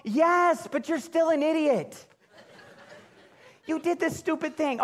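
hammed-up scold of the kids; exasperated dad snapping back; mock angry retort. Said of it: "come back and go".

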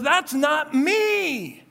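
Peter cursing at the accuser, slow angry denial. Acted. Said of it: "called down curses from heaven".

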